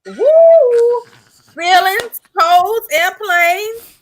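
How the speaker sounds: high loud whooping hype; hype cheer. Real, not acted. Guest cheering her friend on, loud and high. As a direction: whoop it up, then drag the last words slow.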